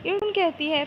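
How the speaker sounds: ordinary delivery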